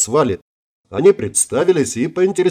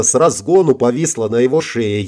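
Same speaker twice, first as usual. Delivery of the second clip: plain reading voice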